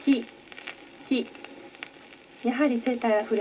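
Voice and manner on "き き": き said alone twice, short and clear; isolated ki. Shown as a demonstration. Pupil pronounces き on the teacher's request, careful. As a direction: say き on its own, deliberately. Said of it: "きを発音してください".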